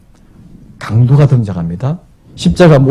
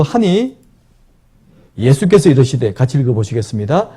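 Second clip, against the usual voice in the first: normal talking voice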